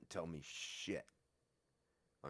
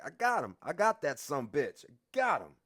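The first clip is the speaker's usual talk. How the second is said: louder, higher excited yell; 9 semitones higher, 11 dB louder than usual. Retold or imitated. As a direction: call out to the guard, proud. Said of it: "And I'm yelling to".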